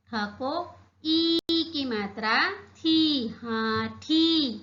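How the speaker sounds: हाथी drawn out very slowly; slow spelling out. Shown as a demonstration. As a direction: stretch थी and हाथी for the class. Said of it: "क्या पढ़ेंगे हम इसे?". (धीरे-धीरे हाथी पढ़ना)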